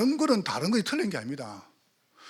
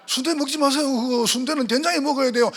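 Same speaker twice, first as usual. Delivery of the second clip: high, loud, laughing mock bossiness; mock insistent; 9 semitones higher, 6 dB louder than usual. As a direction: play the stubborn know-it-all with a laugh. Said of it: "요렇게 말하면 내 중심이라". (웃으며 높고 크게 고집부리듯)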